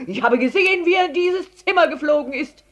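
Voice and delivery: high, loud breathless accusation; breathless from running. A narrator's reading. Herr Letscher hunting the elephant, breathless from running. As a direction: pant it out loudly after a chase. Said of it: "dass er sehr gerannt war".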